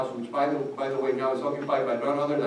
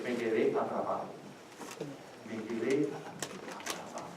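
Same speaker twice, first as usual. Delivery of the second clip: despairing man, quietly; 2 semitones lower, 9 dB quieter than usual. Retold or imitated. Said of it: "He said".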